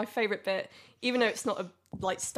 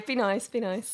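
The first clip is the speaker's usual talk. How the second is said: ordinary talk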